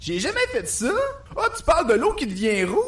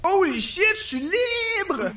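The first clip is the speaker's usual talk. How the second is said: triumphant; 8 semitones higher, much slower than usual. Acted. Jesus freed from tomb.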